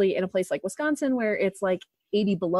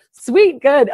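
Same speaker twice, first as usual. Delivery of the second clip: loud, high mock cheer; imitated parents' relief; 6 semitones higher, 9 dB louder than usual. Parents hearing daughter say it right, loud high cheer. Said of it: "We're like".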